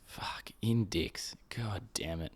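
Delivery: whispered curse under the breath; frustrated whisper. Real, not acted. To herself while searching, a frustrated whisper. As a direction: hiss it out, fed up with the search.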